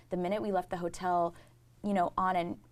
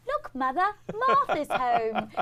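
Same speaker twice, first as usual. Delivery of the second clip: higher, louder, slow hammed-up child actor; mock theatrical little-girl line, drawn out; child stage line; 10 semitones higher, 8 dB louder, slower than usual. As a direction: declaim it like a six-year-old on stage. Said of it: "you were on stage being like".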